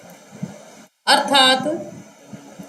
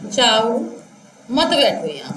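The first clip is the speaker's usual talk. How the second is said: जाओ alone, long pause, then a low मत बैठो यहाँ; pause after जाओ; 4 semitones lower than usual. Shown as a demonstration. Teacher showing what the listener misheard, pausing after जाओ. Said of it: "मैंने सुना".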